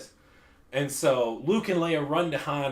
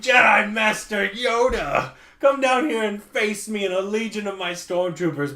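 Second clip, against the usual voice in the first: mock shout; 7 semitones higher, 6 dB louder than usual. Emperor challenges Yoda.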